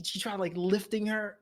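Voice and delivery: ordinary talk, nothing marked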